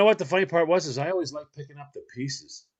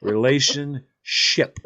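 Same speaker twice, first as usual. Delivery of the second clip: drawn out; much slower than usual. Retold or imitated. Spelling the word out slowly.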